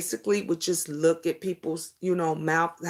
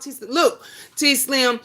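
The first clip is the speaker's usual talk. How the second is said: normal talking voice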